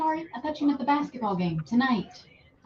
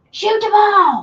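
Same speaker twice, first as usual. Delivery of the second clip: loud, high imitation sideline yell; parent coaching from stands; 9 semitones higher, 12 dB louder than usual. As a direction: holler like an overinvolved sports parent. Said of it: "parents that coach from in the stands".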